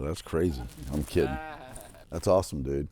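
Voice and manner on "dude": usual speaking voice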